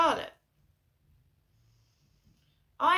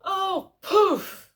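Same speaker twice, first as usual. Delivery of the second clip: high, loud mock-shocked "Oh, poof"; a hammed-up, outraged old mum; shocked gasping exclamation; 9 semitones higher, 9 dB louder than usual. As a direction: squeal it like mum scandalised on the phone. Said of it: "So she suddenly went".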